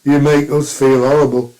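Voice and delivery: loud, sour put-on complaint; grumpy neighbour voice. Mister Plod complaining to the cheerful walker, loud sour voice. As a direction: scold the happy one like a jealous grouch. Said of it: "And he goes".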